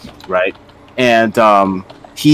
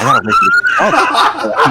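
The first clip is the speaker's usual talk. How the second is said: high laugh through words; 3 dB louder, much slower than usual. Retold or imitated. Gamer laughing while retelling a nervous walk.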